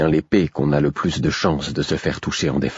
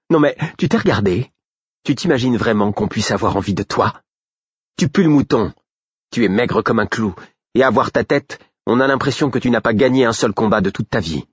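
girl mocking the shepherd, scornful and raised; scornful outburst; 5 semitones higher than usual. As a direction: tear him down with contempt. Girl insulting shepherd boy.

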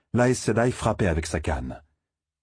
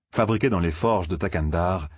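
plain reading voice

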